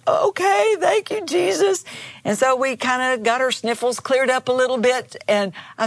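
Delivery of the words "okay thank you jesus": imitated teary woman, much higher; a teary woman finally giving in; tearful, giving in. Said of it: "she was crying a little bit".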